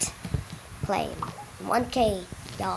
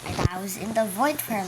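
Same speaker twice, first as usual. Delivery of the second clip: ordinary talk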